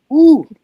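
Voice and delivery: high, loud wince of remembered pain; pained ooh. Real, not acted. Friend recalling the ear sting, pained ooh. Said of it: "you wanna talk about some pain".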